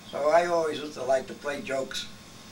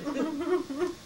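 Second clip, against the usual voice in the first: laughing retelling of a panic; mock alarm, breaking into laughter; 8 semitones higher than usual. Retelling Johnny grabbing at the fake snake, laughing.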